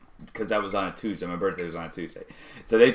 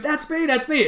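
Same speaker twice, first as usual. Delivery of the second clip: much higher excited repeat. Retold or imitated. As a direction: blurt it out, thrilled. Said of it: "I'm starting to go".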